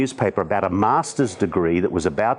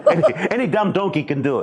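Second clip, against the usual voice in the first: laughing retake, higher and fast; 9 semitones higher, faster than usual. Shown as a demonstration. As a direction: laugh through the line again. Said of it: "Do you wanna say it one more time?".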